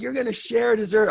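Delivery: high, loud teasing through laughter; laughing mockery. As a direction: rip into a friend, laughing. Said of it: "I ripped into him".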